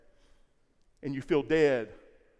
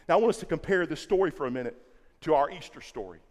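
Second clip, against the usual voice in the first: usual speaking voice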